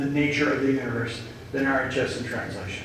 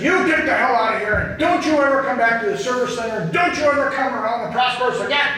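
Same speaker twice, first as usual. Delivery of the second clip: retold angry order; 6 semitones higher, faster than usual. Thane banishing Richard from the center, mock angry. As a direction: throw him out, building anger.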